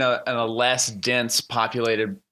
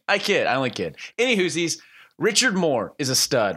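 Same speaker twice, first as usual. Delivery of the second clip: usual speaking voice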